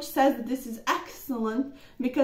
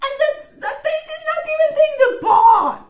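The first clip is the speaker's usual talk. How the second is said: high, loud, delighted laughing shout; 9 dB louder than usual. Real, not acted. Player tells viewers her gamble worked, shouting with laughter. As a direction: burst out laughing in triumph.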